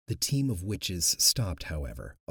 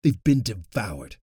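louder grim announcement; 8 dB louder than usual. Acted. Luke telling the coven what happened, loud grim announcement. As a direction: declare it flatly and loud. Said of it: "Luke announced".

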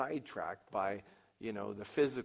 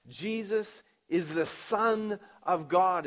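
slow, higher and louder proclamation; proclaiming; 6 semitones higher, 7 dB louder, slower than usual. Retold or imitated. Saul preaching in the synagogues, slow and proclaiming. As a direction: announce it to the whole synagogue. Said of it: "he proclaims in the synagogues".

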